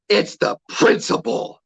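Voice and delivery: slow, drawn-out husky mock retort; mimicked huffy customer. Rude customer justifying refund over napkin, mimicked huffy insistence. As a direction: draw out each word, mimicking her.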